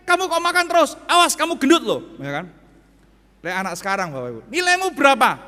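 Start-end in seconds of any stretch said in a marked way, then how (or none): 0.1-2.0 loud, high scolding mother; stern old-school parent. Retold or imitated. Old-style mother nagging child. 4.5-5.4 high, loud question; mother asking. Retold or imitated. Modern mother asking grade.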